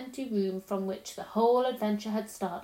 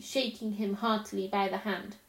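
plain reading voice

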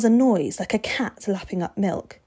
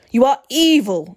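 loud, raised denouncing shout; shouted accusation; 5 semitones higher, 7 dB louder than usual. A narrator's reading. Vampire denouncing the boy, shouting. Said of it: "Mister Crepsey shouted".

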